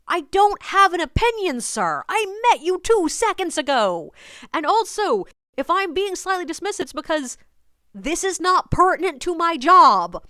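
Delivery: high, loud, emphatic pushback; exasperated retort. Retold or imitated. Tour guide fed up with a pushy stranger, exasperated.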